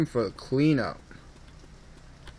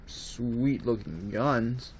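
very slow, softer relish; savouring drawl; 2 semitones lower, 7 dB quieter, much slower than usual. Real, not acted. Player admiring sweet looking guns, savouring drawl. Repeats it, even more drawn out.

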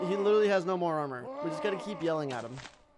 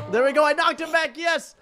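high, loud, elated burst; triumphant shouting; 9 semitones higher, 9 dB louder than usual. Real, not acted. Gamer knocking the opponent back, triumphant shout. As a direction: celebrate at full voice.

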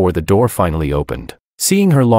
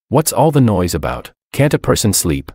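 plain reading voice

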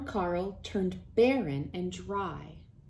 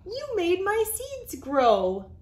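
high, louder delighted mouse voice; grateful mouse; 11 semitones higher, 4 dB louder than usual. Acted. Field mouse thanks the worm, high delighted squeak. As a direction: beam with thanks as a tiny mouse. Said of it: "said the mouse".

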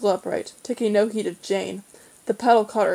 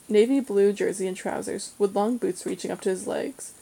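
ordinary delivery, nothing marked

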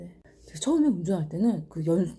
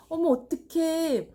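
high, drawn-out helpless little wail; mock helpless fretting; 8 semitones higher, slower than usual. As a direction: mimic someone uselessly meek in a crisis. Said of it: "이러면 등신 아니야?".

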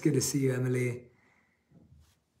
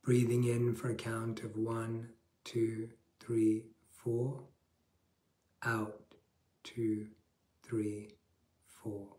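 guided meditation; 2 semitones lower, 6 dB quieter than usual. Acted. Leads a guided breathing meditation.

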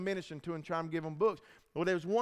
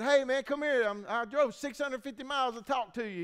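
calling out high, loud on the first words; retold yelling; 8 semitones higher, 4 dB louder than usual. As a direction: re-enact hollering over to the inmates. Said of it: "when I first started yelling at everybody".

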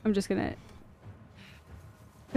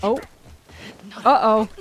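normal talking voice

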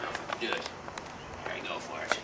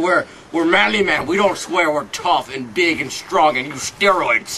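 mock-manly scolding; 5 semitones higher, 9 dB louder than usual. Mock scolding about swear words.

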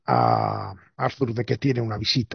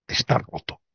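whispered; 3 dB quieter than usual. Real, not acted. Repeated verdict, first whispered.

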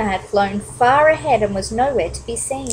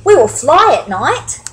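higher, louder eager bat voice; storybook excitement, pitched up; excited; 5 semitones higher, 7 dB louder than usual. A narrator's reading. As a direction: promise it eagerly to your friends. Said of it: "Stellaluna said excitedly".

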